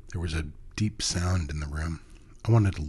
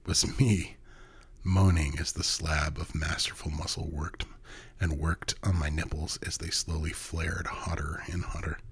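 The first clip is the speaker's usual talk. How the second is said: usual speaking voice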